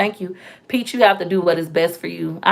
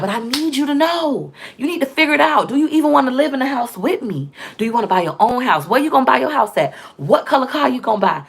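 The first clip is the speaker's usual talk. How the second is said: higher, urgent pushing to her man; insistent raised voice, pressing him for answers; pressing questions; 4 semitones higher than usual. Retold or imitated. Demanding answers about the house from her partner, higher and urgent. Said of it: "I'm like".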